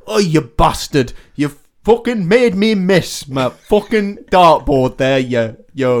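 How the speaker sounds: hammed-up angry Yorkshire accent, slow and loud; Sean Bean. Darts player cursing whoever made him miss, loud mock Yorkshire fury. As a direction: rage slowly in broad Sheffield tones. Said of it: "gonna do a Sean Bean".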